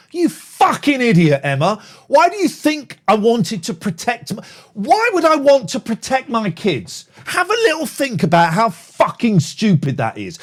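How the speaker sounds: loud, high furious yelling; furious rant. Real, not acted. [name] yelling at commenter Emma, furious. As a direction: rage at the accusation.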